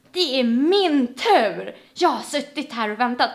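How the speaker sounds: loud, drawn-out indignant protest; imitated shouting. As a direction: act out the angry man insisting loudly. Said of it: "bara skriker bara".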